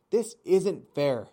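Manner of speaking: loud, slow squawk of a gosling; squawking protest. A narrator's reading. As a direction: stomp and squawk it out. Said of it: "stomped around the garden and squawked".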